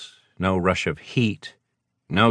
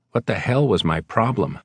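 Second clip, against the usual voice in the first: ordinary delivery, nothing marked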